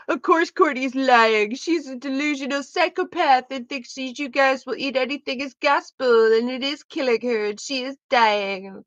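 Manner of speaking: mock Lily as a ditzy Valley girl; a hint of a whiny Valley girl; Valley girl impression. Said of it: "the classic Valley girl".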